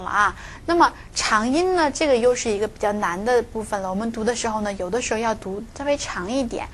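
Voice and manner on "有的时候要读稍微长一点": ordinary talk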